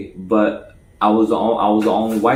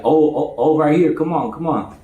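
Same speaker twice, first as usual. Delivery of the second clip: higher, eager beckoning voice; eager calling; 5 semitones higher than usual. Retold or imitated. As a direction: call him over eagerly. Friend eagerly calling him over.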